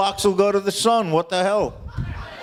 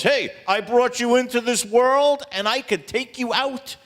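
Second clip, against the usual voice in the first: mad mother; 7 semitones higher than usual. Acted. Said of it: "she got so mad at me".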